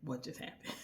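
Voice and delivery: soft, breathless, stunned whisper. Retold or imitated. Noah after the kiss, a dazed whisper.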